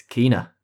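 Kena said KEE-nuh; correct pronunciation. Shown as a demonstration. Host correcting the game's name, Kena as KEE-nuh. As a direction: say Kena with a long ee. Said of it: "we've been saying it wrong".

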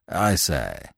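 put-on lightly apologetic, low-pitched young gent; mild, hesitant apology. A narrator's reading. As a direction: break in meekly, pitched low. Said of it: "he said apologetically".

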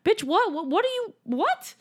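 high, baffled repeated whats; incredulous. Real, not acted. Baffled rant at the nurse's choice. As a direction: sputter in disbelief.

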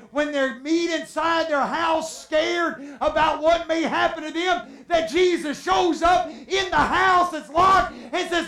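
high, loud sustained pulpit crescendo; impassioned preaching. Real, not acted. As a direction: drive each phrase home at full force. Preaching at full force.

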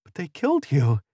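quavering. A narrator's reading. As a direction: quaver in shock at the impossible. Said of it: "her voice quavering".

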